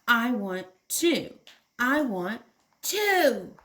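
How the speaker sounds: put-on slow, whining tantrum child; whiny child. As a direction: whine it like a sulking toddler. Child's tantrum in picture book.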